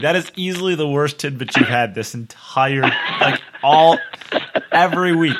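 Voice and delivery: loud, high, emphatic protest; amused outrage. Real, not acted. Co-host declaring Rob's tidbit the worst, loud amused outrage. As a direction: stretch the key words in exasperated disbelief. Outraged verdict on bad joke.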